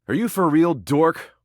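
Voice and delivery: high-pitched, incredulous jab. Acted. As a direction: question them in high-pitched disbelief. Incredulous retort to the listener.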